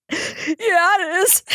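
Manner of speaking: high, slow, tearful imitation of him crying; mock sobbing boyfriend. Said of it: "he is bawling".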